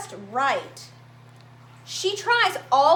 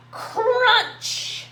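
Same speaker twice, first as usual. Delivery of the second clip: sound-effect word; 9 semitones higher, 4 dB louder than usual. A narrator's reading. Finger gets crushed in story.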